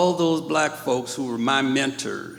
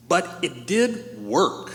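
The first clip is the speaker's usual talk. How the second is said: normal talking voice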